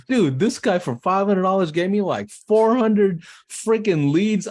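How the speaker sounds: high, loud, stretched-out disbelief; thrilled, dragging out freaking leads; ecstatic. Retold or imitated. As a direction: marvel at the huge haul. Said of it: "I'm like".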